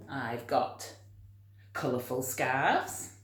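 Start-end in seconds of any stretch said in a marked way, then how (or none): none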